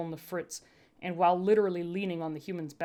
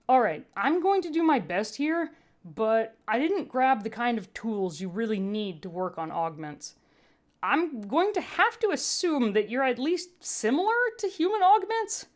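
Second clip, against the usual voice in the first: higher, lightly worried medic voice; medtech voice, resigned; 5 semitones higher, 3 dB louder than usual. A narrator's reading. Medtech readies to patch up the sec unit, higher and uneasy. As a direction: brace yourself for a hard job, rising up.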